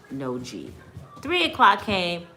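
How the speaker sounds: normal talking voice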